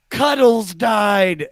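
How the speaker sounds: slow, drawn-out mock weeping; mom weeping. Son retells mom weeping over the cat, slow mock sob. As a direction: stretch it out like a grieving mom. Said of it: "And she goes".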